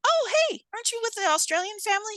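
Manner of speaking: high-pitched surprised greeting; acted-out delighted double take; surprised recognition. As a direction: call out as you suddenly recognize someone. Greeting a recognized kid in retelling.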